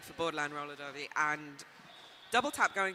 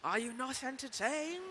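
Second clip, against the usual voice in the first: higher, hammed-up arena cry; gladiator quote; 6 semitones higher than usual. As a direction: play the gladiator to the crowd. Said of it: "gladiator movie quote".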